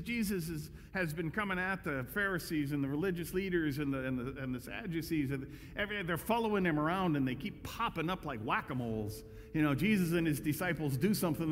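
normal talking voice

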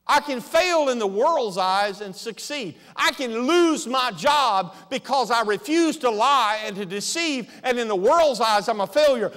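loud, emphatic sermon delivery; preaching at full force. Acted. Pastor to congregation, loud preaching. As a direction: preach it out, hammering each line.

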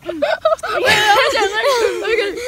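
slow laughing words. Real, not acted. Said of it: "laughing while filming".

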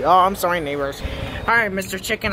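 normal talking voice

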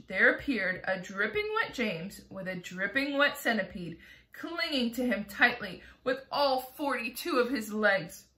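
ordinary delivery

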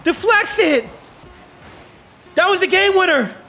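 high-pitched, breathless-suspense sportscasting; real play-by-play excitement; excited climax call. Real, not acted. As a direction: call a game-deciding play at full excitement. Live call of last-second shots.

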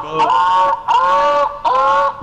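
high, hammed-up mock moans; mock moaning. Rival mocking a player's mother in chat, mock moaning. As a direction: ham up fake moaning to provoke him. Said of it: "why are you moaning in the mic".

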